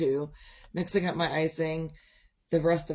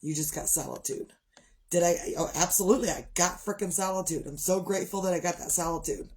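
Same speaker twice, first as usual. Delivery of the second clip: ordinary talk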